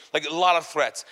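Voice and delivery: ordinary talk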